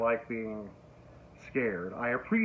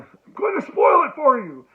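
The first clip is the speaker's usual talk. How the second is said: a hammed-up shout, much higher and louder; mock shout; 12 semitones higher, 11 dB louder than usual. Vlogger jokingly yelling a spoiler warning at viewers. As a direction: yell the spoiler warning like a playful threat.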